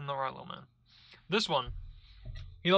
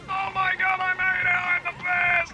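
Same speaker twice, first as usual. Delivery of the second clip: much higher and louder, building to a gloating cheer; triumphant excitement; 14 semitones higher, 5 dB louder than usual. Real, not acted. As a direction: cheer yourself over the finish. Barely making it in time.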